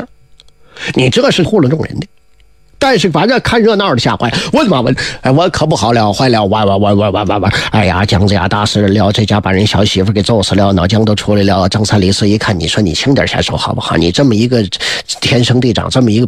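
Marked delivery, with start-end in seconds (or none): 4.5-11.7 stammering mock panic, pitched lower; a hammed-up scared bystander stuttering over the words; panicked onlooker. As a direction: bystander frightened by the killing, stammering.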